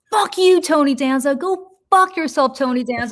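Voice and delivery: chasing the man down the street, screaming furiously; furious shout. Retold or imitated. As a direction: scream it down the street after him. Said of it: "I lose my shit".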